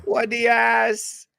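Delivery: hammed-up Jamaican accent. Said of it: "that's not a Jamaican accent".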